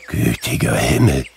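low, awestruck cry of wonder; awed exclamation. A narrator's reading. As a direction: gasp it out in awe. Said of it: "rief Scrooge". (ehrfürchtiger, tiefer Ausruf)

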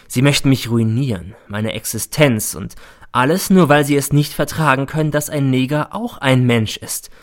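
plain reading voice

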